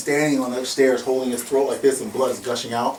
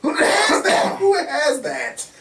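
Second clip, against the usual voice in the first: much higher, slow, stretched-out bragging; squealing brag; much slower than usual. Retold or imitated. Officer bragging that no school has such footage, high squealing brag. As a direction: crow it like a kid showing off a prize.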